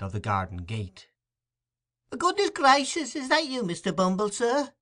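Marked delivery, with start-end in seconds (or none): 2.2-4.7 high, gushing housekeeper voice; mock ecstatic joy. A narrator's reading. Mrs Mann greets the beadle in feigned high joy. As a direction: falsetto delight at the beadle. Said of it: "in well-affected ecstasies of joy".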